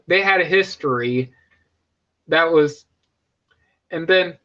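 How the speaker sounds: normal talking voice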